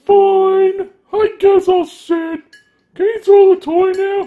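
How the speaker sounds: high, slow whining pup voice; whiny dog voice. Pretend. Voicing the dog's reply.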